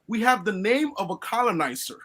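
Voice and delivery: usual speaking voice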